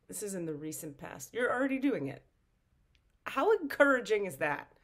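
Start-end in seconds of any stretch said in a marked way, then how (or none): 1.3-2.2 higher, louder, delighted repeat; excited. Real, not acted. 3.3-4.7 higher and louder, beaming; excited. Real, not acted. Delighted rhetorical question.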